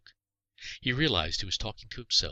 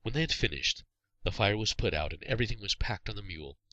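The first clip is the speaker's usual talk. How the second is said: plain reading voice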